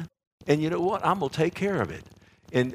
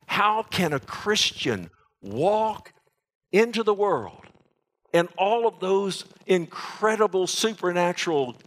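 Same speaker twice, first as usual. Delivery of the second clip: preaching at full force; 7 semitones higher than usual. Acted. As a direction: rouse the congregation, voice rising.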